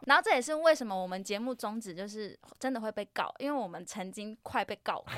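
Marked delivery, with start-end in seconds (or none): none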